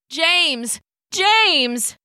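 calling from a distance, high and loud. Acted. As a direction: call his name across the yard. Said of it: "Tom calling from the cabin door".

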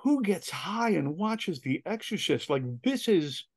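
high, drawn-out disbelief; acted incredulity. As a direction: retell it as stunned, slow protest. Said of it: "I'm just sitting there going".

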